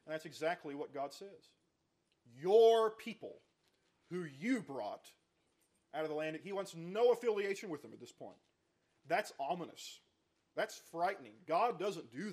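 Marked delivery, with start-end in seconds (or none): 2.2-6.4 slow, louder, drawn-out rebuke on your; God's rebuke. Acted. Preacher voicing God rejecting Israel, slow and loud. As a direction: lean hard on your and let it ring.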